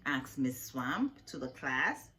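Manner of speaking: usual speaking voice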